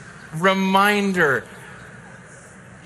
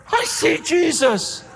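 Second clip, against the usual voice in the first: ecstatic Stephen; 8 semitones higher than usual. Retold or imitated. Stephen facing the stones, rapturous shout. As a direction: shout it with joyful defiance. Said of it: "that he goes".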